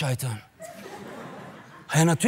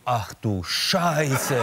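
dismayed; 4 semitones lower, much slower than usual. Retold or imitated. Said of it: "Und ich so".

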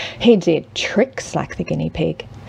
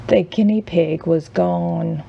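usual speaking voice

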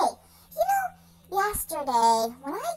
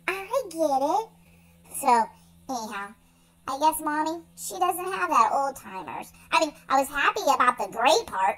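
ordinary talk, nothing marked

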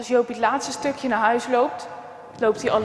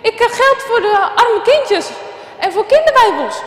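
high, loud stammer, cutting in; higher stammering kid's voice calling through; stammering boy calling out; 9 semitones higher, 5 dB louder than usual. Acted. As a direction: blurt it out over the others, stuck on every word. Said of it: "roept hij er doorheen".

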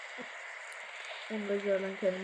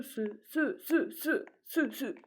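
normal talking voice

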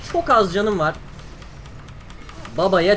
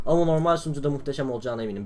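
usual speaking voice

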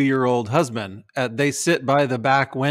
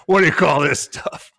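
grouchy viewer; 3 semitones higher, 4 dB louder than usual. Retold or imitated. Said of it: "grouchy viewer".

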